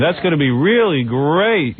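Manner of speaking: slow, drawn-out mock gushing; Rosie O'Donnell. Imitated host praising the coming movie, slow mock gush. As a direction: stretch it out, overimpressed. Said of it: "she says".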